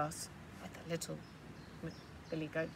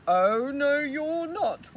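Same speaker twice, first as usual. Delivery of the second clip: much louder troll roar; bellowing refusal; 6 semitones higher, 12 dB louder, much slower than usual. Acted. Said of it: "roared the troll".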